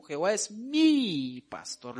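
"mi" stretched long and high; énfasis en mi. Shown as a demonstration. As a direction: lean hard on "mi", drawing it out. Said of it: "lo dice en singular". ("mi" alargado y enfático)

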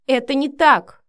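loud, high cry of denial; exclaiming. A narrator's reading. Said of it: "воскликнула Вика". (громко восклицая)

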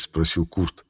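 plain reading voice

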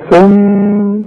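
ثم held with a nasal hum, then stopped; ghunna on stop. Shown as a demonstration. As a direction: pause on ثم with a two-beat hum. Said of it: "شوف الغنة دي".

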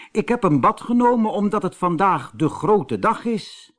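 high, bright-eyed little mountain man; high little gnome voice, proud of his big day; cheerful little man. A narrator's reading. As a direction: play the tiny man, pleased and chatty.

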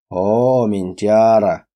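put-on slow, drawn-out drunk greeting. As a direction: stretch «ментяра» lazily, very slowly. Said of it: "растягивая пьяным голосом". (пьяный растянутый голос)

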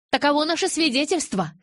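high, loud, resolute statement; firm declaration. A narrator's reading. Witness closing her testimony before the elders, firm and loud. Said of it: "твёрдо заявила Маккена".

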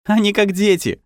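put-on high, ringing child's voice; ringing little voice. A narrator's reading. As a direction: chime it out like a little kid. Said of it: "прозвенел чей-то голосок".

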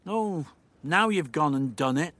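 plain reading voice

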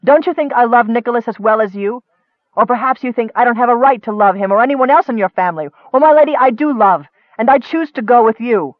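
angry. A narrator's reading. Cleric defending his love and choice to Nicole, angry. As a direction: argue back hotly, pitch up. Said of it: "suddenly irrationally angry".

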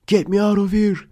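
very high, loud desperate scream. Retold or imitated. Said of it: "diye bağırıyor böyle kameraya". (kameraya tiz, yüksek sesle bağırarak)

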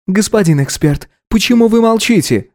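high appealing woman voice; pleading woman. A narrator's reading. Liana appeals.

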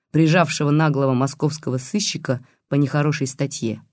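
ordinary delivery, nothing marked

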